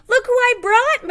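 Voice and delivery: high, loud excited announcement; proud excited moose. A narrator's reading. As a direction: show off your catch. Moose proudly shows turkey.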